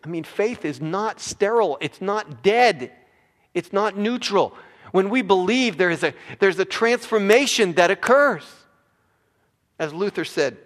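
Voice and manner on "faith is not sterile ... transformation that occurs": loud, raised pulpit voice; emphatic preaching. Real, not acted. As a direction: hammer each denial home. Preaches at full force.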